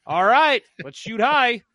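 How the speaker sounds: team rallying cry. Retold or imitated. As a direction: pump up the team before the game. Team psyching up at the bench.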